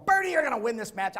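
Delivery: loud, high call from the corner; coach yelling encouragement. Retold or imitated. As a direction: yell it across the mat to your wrestler. Coach yelling during the match.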